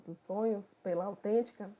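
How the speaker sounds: usual speaking voice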